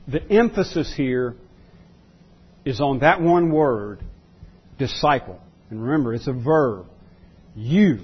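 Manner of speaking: normal talking voice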